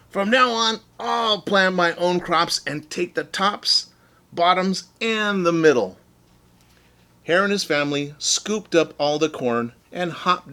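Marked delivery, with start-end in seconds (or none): 0.1-6.0 angry Bear. Acted. Bear vowing to plant his own crops, angrily. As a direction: Bear swearing off Hare for good. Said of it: "he hollered".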